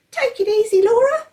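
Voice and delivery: calm her down in a tiny voice; baby George soothing his sister. A narrator's reading. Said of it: "said George".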